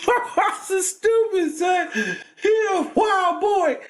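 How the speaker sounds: laughing. Real, not acted. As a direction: crack up about pops.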